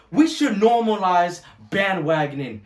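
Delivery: ordinary talk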